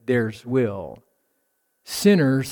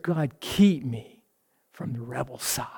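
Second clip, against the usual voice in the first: whispered prayer; 2 semitones lower, 7 dB quieter than usual. Acted. Preacher praying to God, whispered. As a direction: pray it softly under the breath. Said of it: "my prayer is".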